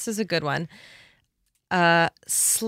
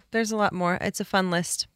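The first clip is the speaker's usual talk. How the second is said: usual speaking voice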